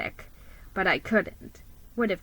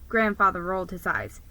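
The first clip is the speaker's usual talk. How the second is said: plain reading voice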